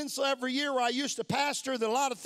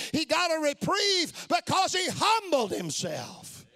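full-force preaching; 4 semitones higher than usual. Acted. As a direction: preach it out to the back pews.

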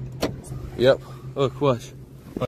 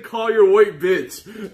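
higher, louder, slow mock voice; slowed-down, pitched-up mock of her line; 7 semitones higher, 5 dB louder, much slower than usual. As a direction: drag it out imitating her. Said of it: "she said".

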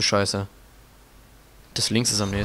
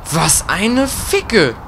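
drawn-out curse; 6 semitones higher, much slower than usual. Real, not acted. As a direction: stretch out the frustration. (langgezogen fluchen)

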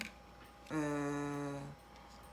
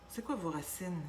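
usual speaking voice

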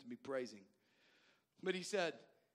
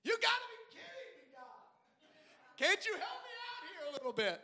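much higher put-on voice, half laughing; exasperated plea to God; 13 semitones higher than usual. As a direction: whine up high at the heavens in mock disbelief. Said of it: "I was like".

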